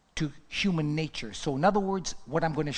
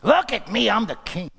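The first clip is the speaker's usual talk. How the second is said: mock boasting; 6 semitones higher, 6 dB louder than usual. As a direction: boast starting high and loud. Mocks prideful boasting.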